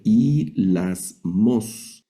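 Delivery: "y las mos" very slowly, syllable by syllable; Greek word sounded out. Shown as a demonstration. Teacher reading Greek aloud for the class, slow syllabic pronunciation. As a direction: spell out "y las mos" in long, separate syllables. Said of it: "Aquí vamos a leer".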